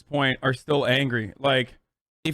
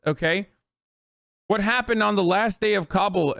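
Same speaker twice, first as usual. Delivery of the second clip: ordinary talk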